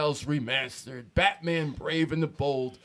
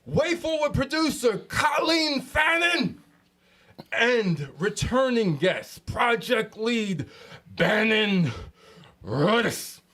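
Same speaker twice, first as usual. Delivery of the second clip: hype announcer intro; 6 semitones higher than usual. Real, not acted. Hyped guest introduction.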